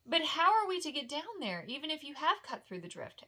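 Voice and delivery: Pippin's voice, lightly sketched and anxious; lightly voiced hobbit. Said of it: "asked Pippin".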